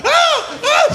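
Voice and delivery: very high panicked shrieks; monkey screech. Retold or imitated. Itchy space monkey in its helmet, shrieking. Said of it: "they're like".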